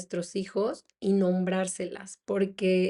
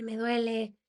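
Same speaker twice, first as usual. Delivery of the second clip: a higher, thinner mock child's whimper; imitating a crying child; 3 semitones higher, 4 dB quieter than usual. Mother retelling her daughter crying from the dressing, mock whimper. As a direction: voice the hurt girl's cry, lightly. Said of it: "pero mi hija empezó a gritar, a llorar".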